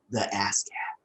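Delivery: slow, whispered and drawn out. Shown as a demonstration. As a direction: breathe it out slowly. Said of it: "It sounds gross when you say it's that slow".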